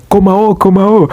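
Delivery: high-pitched calling out; mock shouting. Boda boda rider urging passenger to return, calling out. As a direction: imitate a rider calling someone back. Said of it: "boda boda guy started shouting".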